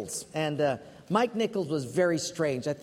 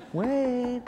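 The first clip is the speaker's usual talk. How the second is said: pleading call; 6 semitones higher than usual. Retold or imitated. Mike calling again.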